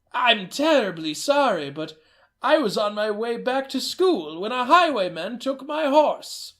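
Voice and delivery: a hint of a young grandson; young man's voice. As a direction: play the hurried grandson. Man posing as grandson.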